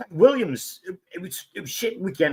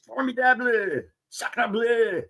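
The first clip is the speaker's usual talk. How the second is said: mock French; 6 semitones higher, slower than usual.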